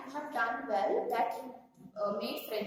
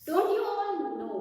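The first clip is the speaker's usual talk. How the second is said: mildly roared lion challenge, higher pitch; a somewhat raised, commanding lion voice; roaring lion; 6 semitones higher, 4 dB louder than usual. Acted. Lion king confronting all the animals, mild roar. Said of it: "roaring, said in a loud voice".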